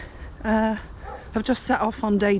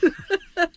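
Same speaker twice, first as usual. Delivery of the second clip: tearful laugh; 11 semitones higher than usual. Real, not acted. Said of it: "oh, I'm gonna get emotional".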